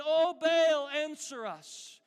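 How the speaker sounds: loud, high cry to a god; calling on Baal. Acted. Prophets plead with their god from morning until noon, calling out. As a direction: cry out, stretching the plea slowly. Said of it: "they called upon the name of Baal".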